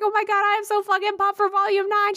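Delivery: high-pitched retold fangirl gush; excited gushing. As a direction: gush up high like a starstruck fan. Starstruck fan gushing, retold.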